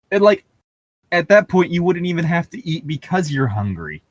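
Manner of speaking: ordinary talk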